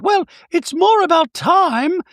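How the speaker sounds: very high, slow, affected mock Dorit; high slow mocking Dorit bragging about co-authors. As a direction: play her as a haughty caricature. Dorit voiced in recap.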